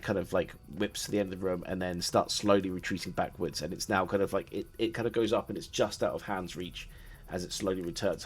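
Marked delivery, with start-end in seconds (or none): none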